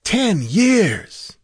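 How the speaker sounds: high, swooping exclamation of disbelief; high-pitched astonishment at lost time; astonished stranger. A narrator's reading. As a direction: cry out in amazement, pitch leaping up. Stranger learns ten years passed.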